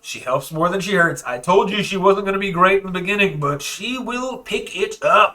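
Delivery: insistent defense. Acted. NPC insists the helper improves.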